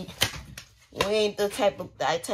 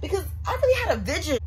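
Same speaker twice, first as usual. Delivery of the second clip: high, fast, half-crying complaint; frustrated cry over a failed vision; whiny frustration; 9 semitones higher, faster than usual. Real, not acted. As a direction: whine it out, near tears. Frustrated with failing hairstyle.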